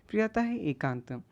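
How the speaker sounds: ordinary talk, nothing marked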